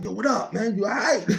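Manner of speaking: a high, hammed-up greeting to a friend; light mock voice, asking what's up. Narrator greeting his friend K-Dog in a high mock voice.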